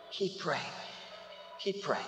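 very soft, low and gentle; hushed urging. Real, not acted. Preacher urges those praying for loved ones, hushed. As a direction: drop to a quiet, earnest appeal.

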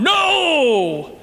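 a sudden high shouted no; hammed-up shout of outrage; mock shout. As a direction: bark it down like an angry crowd. Others shout down your belief.

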